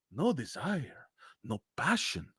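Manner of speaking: suddenly very soft; dramatic hush. Acted. Scolding viewers for no passion, very hushed. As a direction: drop to a hushed, dramatic finish.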